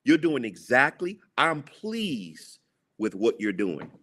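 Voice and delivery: usual speaking voice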